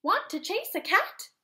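eager puppy asking to play; excited dog voice. Acted. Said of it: "barked the dog".

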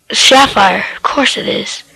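ordinary delivery

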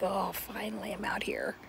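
breathy, relieved whisper on a sigh; sigh of relief. Retold or imitated. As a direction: breathe it out like sinking into the chair. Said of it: "breathe this big sigh of relief".

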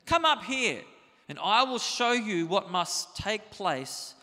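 high, loud, slow proclamation; trumpet-like call. A narrator's reading. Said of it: "the voice ... like a trumpet said".

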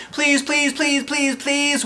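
put-on high, pleading kids' chorus; begging. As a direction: beg over and over. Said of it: "we all begged".

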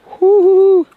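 high cold-shock whoop. Real, not acted. Stepping into cold water.

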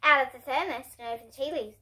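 ordinary delivery, nothing marked

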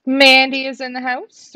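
loud, high, announcer-style shout-out; excited welcome. Real, not acted. Host greeting a viewer joining the stream, excited. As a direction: hype up the arrival like a ring announcer.

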